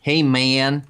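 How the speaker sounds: put-on Swede putting on an American accent; exaggerated American drawl, mocking; dopey imitation American accent. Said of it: "speak English in American accents like dummies".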